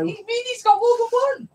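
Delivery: high, loud disbelieving question; can't believe what she's hearing; surprised. Real, not acted. Shocked at Brian's many homes.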